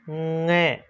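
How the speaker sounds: ङ as a held nasal ng from the back of the mouth; teacher demonstrating ङ as ng; nasal ng sound. Shown as a demonstration. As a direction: hum ङ through the nose like ng. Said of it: "इसको ङ बोला जाता है".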